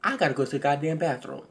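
quick, quiet throwaway mumble of I gotta go to the goddamn bathroom; lame excuse. Retold or imitated. Mocking the ex's lame exit line, quick and mumbled. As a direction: mumble the excuse softly and fast, like dodging. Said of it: "And the best line you got is".

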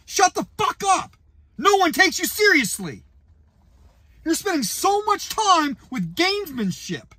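high, yelling at the coach; high-pitched angry yelling; furious rant. Real, not acted. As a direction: tear into the coach.

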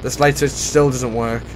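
ordinary delivery

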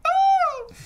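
short high-pitched scream; sudden sharp shriek of pain. Acted. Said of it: "hearing the scream".

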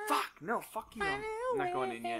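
high frantic refusal; panicked protest. Real, not acted. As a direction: beg not to go in. Refusing to enter training.

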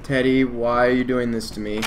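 ordinary talk

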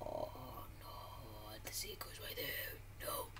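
hushed whisper. Real, not acted. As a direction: whisper it so nobody hears. Pointing out a secret spot.

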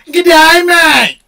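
high, loud "G'day, mate" with a long "mate"; Aussie greeting. Shown as a demonstration. Showing a friend how to greet Aussies, loud and high. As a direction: call it out like a cheery Aussie. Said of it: "you hustle them like this".

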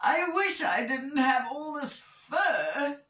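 high-pitched complaining wish; hammed-up moany bear; moaning. A narrator's reading. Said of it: "moaned Big Bear".

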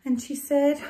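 ordinary delivery, nothing marked

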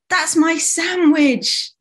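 high, drawn-out protest; indignant cry. Acted. Summer cries out to protect lunch, indignant high cry. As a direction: protest slowly at a high pitch. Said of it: "cried Summer".

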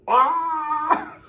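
high startled "Ah!"; mock shocked cry. Imitating the crowd seeing the kick, a high startled cry.

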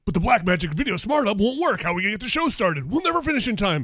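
high, hammed-up anime worry; anime panic. Host in anime skit frets about show, mock panic.

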